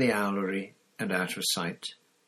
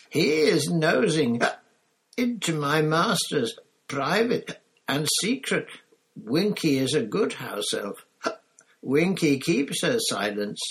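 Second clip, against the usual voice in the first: high, loud, slow elf squeak; stubborn house elf guarding her secret; squeaky elf drawing out every word; defiant drunk elf; 7 semitones higher, 3 dB louder than usual. Acted.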